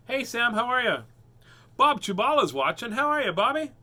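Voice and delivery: excited greeting. Pretend.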